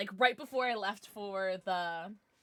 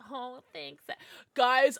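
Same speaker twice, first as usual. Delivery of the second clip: ordinary delivery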